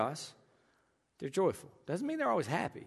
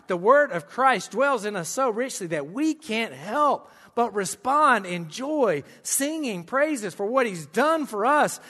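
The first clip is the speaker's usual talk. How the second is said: slow, loud, drawn-out preaching; preaching with slow, swelling force; fervent preaching; 5 semitones higher, 5 dB louder, slower than usual. Acted. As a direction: lean into each phrase, lifting the room. Preaching builds to full force.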